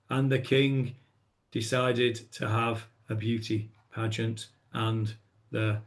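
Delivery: slow, low and soft, reading it flat; dull bedtime monotone. Shown as a demonstration. Teacher showing how not to read, slow and flat. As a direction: drone it out like a sleepy bedtime read. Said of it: "if you tell it like that".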